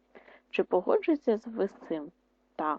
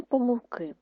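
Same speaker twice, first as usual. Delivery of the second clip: помилки with stress on -ки, higher; stress demo; 4 semitones higher than usual. Shown as a demonstration. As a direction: lean on the last syllable of помилки. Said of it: "а на помилки тільки один". (наголос на останньому складі)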